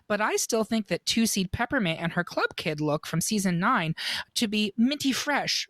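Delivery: normal talking voice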